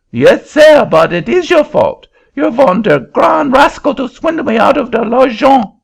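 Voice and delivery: put-on a Frenchman in a fury in a French accent; furious French accent. Frenchman demanding his money back from the auctioneer, furious French accent.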